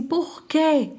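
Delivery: high, insistent retold question; imitating an incredulous father; demanding father. Acted father demanding a reason.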